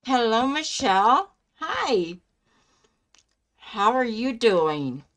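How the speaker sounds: normal talking voice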